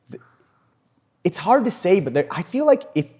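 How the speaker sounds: ordinary talk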